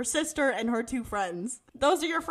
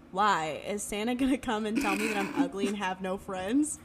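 acted Spencer; 5 semitones lower, 5 dB quieter than usual. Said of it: "he goes".

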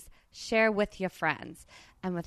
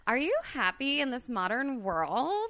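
lightly voiced Valley Girl, giggling between lines, pitched higher; 6 semitones higher than usual. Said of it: "Let's do it like Valley Girls".

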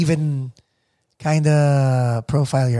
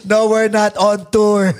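put-on slow, high, loud Eagle Rock accent; laughing imitation of Eagle Rock Filipino vowels; 5 semitones higher, 5 dB louder, slower than usual. Host answering the plaza Filipinos in kind, Eagle Rock accent. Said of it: "because I would hear them".

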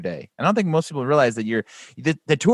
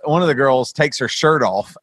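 normal talking voice

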